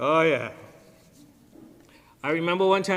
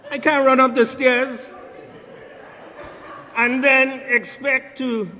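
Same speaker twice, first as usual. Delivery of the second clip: higher, louder mock exasperated husband; loud, high comic exasperation; retold punchline; 7 semitones higher, 6 dB louder than usual. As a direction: belt out the punchline for the laugh. Punchline of retold joke, Cliff voiced.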